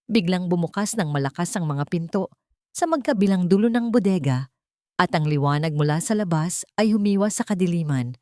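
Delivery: usual speaking voice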